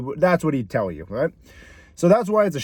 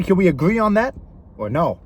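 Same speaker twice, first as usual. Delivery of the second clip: ordinary delivery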